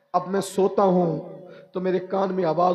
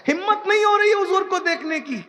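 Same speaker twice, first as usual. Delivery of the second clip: tearful plea; 11 semitones higher than usual. Retold or imitated. Weeping man tells Umar he lacks courage, tearful plea. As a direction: plead in a high, weeping pitch. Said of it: "میں نے اس کو روتے دیکھا".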